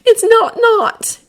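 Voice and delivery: high, slow insisting; insistent. Acted. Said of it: "Weasley insisted".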